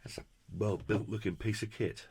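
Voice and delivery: ordinary delivery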